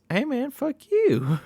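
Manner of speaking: mock stoner clerk. The clerk cursing back at Bear, a high mock dude voice. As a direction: toss it back like a sneering slacker.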